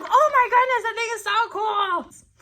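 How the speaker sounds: high, loud gasp of delight with a laugh; amazed crowd. Retold or imitated. Teacher voices fairgoers seeing the replica, excited. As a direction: play the wowed fairgoers. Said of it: "people were like".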